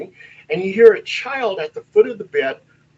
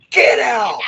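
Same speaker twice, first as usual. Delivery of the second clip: loud, raised bark of a command; ghostly man's voice; 5 semitones higher, 4 dB louder than usual. Retold or imitated. Man's voice on walkie-talkie during seance, commanding. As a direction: imitate a spirit ordering people out. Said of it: "it's a man's voice, and he goes".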